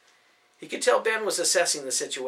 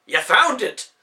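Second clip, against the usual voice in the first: high, loud thrilled cry; delighted discovery; excited; 8 semitones higher, 11 dB louder, slower than usual. Acted. Ben finds Nicholas with the director, thrilled cry.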